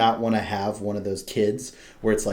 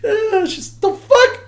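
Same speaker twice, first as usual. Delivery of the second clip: put-on startled baby reaction; 6 dB louder, much slower than usual. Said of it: "She was just like".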